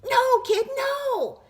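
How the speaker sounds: very high, loud terrified cry; shrieking panic. A narrator's reading. Tooth begging the boy to spare it, shrieking. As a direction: scream in terror at the carrot.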